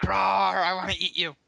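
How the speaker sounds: roaring hungry bear; dinosaur voice. Acted. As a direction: growl it like a hungry beast.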